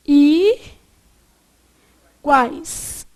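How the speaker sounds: "I, quais" as two parts with a long gap; split into syllables. Shown as a demonstration. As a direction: hold "I", pause, then give "quais". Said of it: "Como é que eu separo?".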